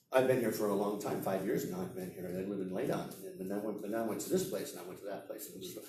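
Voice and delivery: low, quick monotone running sentences together; run-on, no chunking. Shown as a demonstration. Teacher showing students unbroken speech, run-on mumble. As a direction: rattle it off low without any breaks. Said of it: "You gotta break it up".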